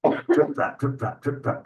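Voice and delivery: light, voiceless trip-trap hoofsteps; clip-clop hoof noises. Acted. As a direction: tap out tiny hoofsteps with the mouth. Imitating hooves on the bridge.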